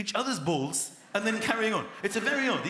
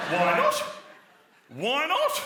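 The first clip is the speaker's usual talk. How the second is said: rising, louder insistence; pushy Australian; 9 semitones higher, 6 dB louder, slower than usual. Retold or imitated. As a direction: push him to swim.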